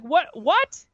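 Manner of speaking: rising, high-pitched disbelief; mock bafflement. Podcaster reacting to an absurd razor ad, mock baffled.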